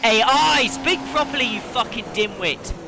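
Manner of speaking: angry self-scolding shout; frustrated yell. Real, not acted. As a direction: snap at his own tongue. Yelling at himself.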